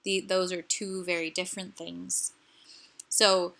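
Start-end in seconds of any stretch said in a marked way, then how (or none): none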